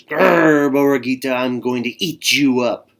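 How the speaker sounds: loud menacing growl, threatening the lamb; growling coyote. Acted. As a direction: snarl it out like a hungry coyote. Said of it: "The coyote growled".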